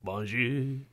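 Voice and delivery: low, soft French crooner. Acted. Panellist demonstrating a French-sounding bloke singing, low soft croon. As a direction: croon it quietly in a mock French accent. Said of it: "a really French-sounding bloke singing a song, it's [name]".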